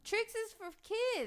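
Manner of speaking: high, slow cartoon commercial voice; cereal ad mascot. Shown as a demonstration. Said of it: "No, do the Trix".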